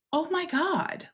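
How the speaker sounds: high-pitched, awed exclamation; retold amazement. Retells her amazement in dream.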